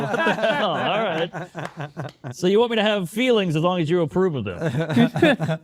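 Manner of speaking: laughing disbelief. Retold or imitated. A man answers women's double standard, laughing in disbelief. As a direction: laugh through the outrage. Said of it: "you're like".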